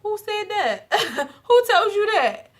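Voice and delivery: very high, mocking who-said-that with a laugh; laughing, high-pitched mock disbelief; incredulous. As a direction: scoff at the idea, voice shooting up. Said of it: "And he just was like".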